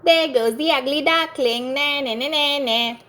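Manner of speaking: high, sing-song teasing chant; mocking taunt. A narrator's reading. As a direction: jeer at him like a playground bully. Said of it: "Everybody looked at him and said".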